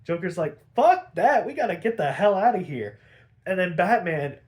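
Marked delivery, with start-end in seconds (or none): 0.8-3.0 loud, higher acted refusal; loud mock exasperation, easing off; exasperated outburst. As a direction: act out Joker losing patience, punching the first words. Joker refuses to wait for others.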